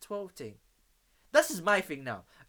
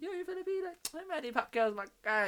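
excited driver; 12 semitones higher, 3 dB louder than usual. Retold or imitated. Uber driver quoted, excited.

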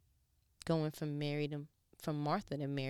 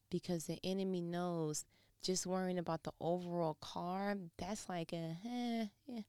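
ordinary delivery

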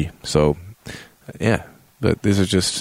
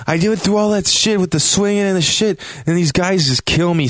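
exasperated; 11 semitones higher than usual. Real, not acted. Ranting about dying in game.

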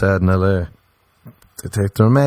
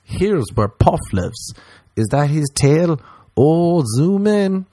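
mock wonder; 8 semitones higher, slower than usual.

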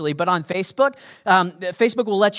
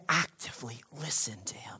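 fading into a whisper; hushed; 7 semitones lower, 14 dB quieter than usual. Shown as a demonstration. Urging listeners to lean in to God, hushed. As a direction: draw them in with a hush.

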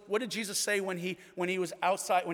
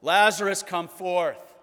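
loud call; 10 dB louder, slower than usual. Retold or imitated. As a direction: call the name out loud, strong. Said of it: "he called out".

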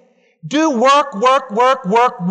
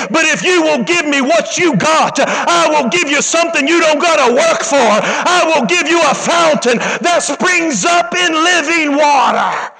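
full-force preaching; 5 semitones higher than usual. Acted. Preaching peak over music.

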